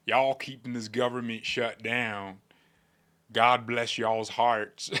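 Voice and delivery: drawn-out Southern drawl; mock Southern politician's drawl; Southern accent. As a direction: put on a folksy Southern accent. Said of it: "if he had a Southern accent".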